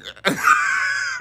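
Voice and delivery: high squealing burst of laughter; shrieking laugh. Real, not acted. Laughing at his own impression.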